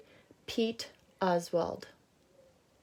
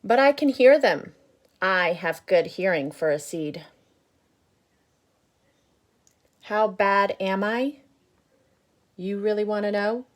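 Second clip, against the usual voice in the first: grumpy bad seed; 3 semitones higher, 11 dB louder than usual. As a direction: boast about being bad, loud. Reading the seed's lines aloud.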